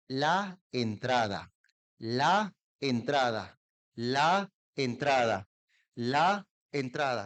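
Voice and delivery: "la entrada" said very slowly, each sound clear; slow careful classroom drill; slow and well articulated. Shown as a demonstration. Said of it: "lentamente y bien articulado".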